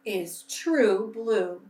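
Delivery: put-on gentle storybook reading for children; bedtime-story narrator voice; story narration. A narrator's reading. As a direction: read it like a picture book to kids at church. Reading a picture book aloud to children.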